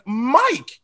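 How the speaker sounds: high incredulous protest. Retold or imitated. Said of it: "I was like".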